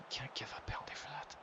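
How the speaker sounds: hissed, whispered protest at the referee; frustrated whisper. Real, not acted. Gamer whispering a protest at the ref's penalty call. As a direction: breathe the complaint under your breath, unvoiced.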